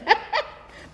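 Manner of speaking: a quick high giggle of delight; giggle, thrilled at the thought; giddy boyish giggle. A narrator's reading. Boy dreaming of his new ball, giggling.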